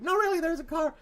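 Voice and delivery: high-pitched pleading mock child; insistent kid. Kid insisting to doubting parents, high-pitched.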